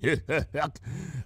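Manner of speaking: soft menacing chuckle; hearty threatening laugh, held back. A narrator's reading. Applejack laughing menacingly at Rarity. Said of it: "a hearty, menacing laugh".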